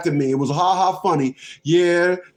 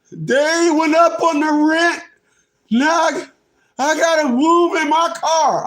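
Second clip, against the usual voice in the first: mock wailing; 9 semitones higher than usual. Mimicking people crying about rent on TV, mock wail. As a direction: wail the complaint like a fake sob.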